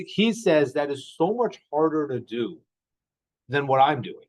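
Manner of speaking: normal talking voice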